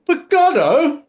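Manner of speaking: high, loud upset question. Shown as a demonstration. Showing one possible reaction in a drama exercise, upset. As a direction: echo it back upset, voice jumping up. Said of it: "it could be upset".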